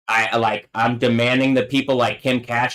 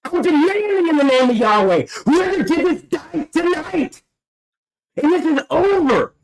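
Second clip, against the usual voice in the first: much higher, strained, steady fury; raging demands. Real, not acted. Calling down doom in Yahweh's name, furious and much higher. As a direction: demand it at the top of the voice. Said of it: "fuck, I'm angry".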